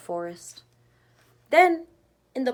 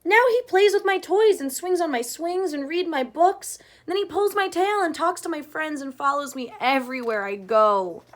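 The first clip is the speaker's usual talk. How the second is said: high, louder whiny list of grievances; complaining child; 6 semitones higher, 6 dB louder than usual. Acted. Chester complains to his mother about Ronnie, high whiny child voice. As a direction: rattle off complaints like a fed-up kid.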